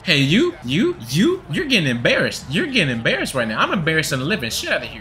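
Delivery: sing-song taunt with swooping pitch. Real, not acted. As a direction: rub it in, swinging up and down. Trash-talking an opponent.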